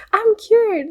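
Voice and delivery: high, loud mock triumph; mock instant happiness. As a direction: squeal it as a joke miracle cure. Repeats mock cure after laughing.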